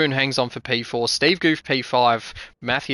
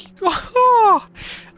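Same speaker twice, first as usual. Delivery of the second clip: high laugh; 5 dB louder than usual. Real, not acted. Laughing after the finish.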